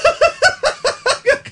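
very high giggle; high squealing laugh. Real, not acted. Host keeps giggling.

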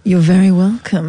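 slow mock creepy echo; a hint of a creepy caller; slowly echoing the caller's greeting to tease him; creepy mimicry. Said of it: "That's a bit creepy".

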